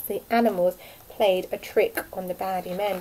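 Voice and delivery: ordinary delivery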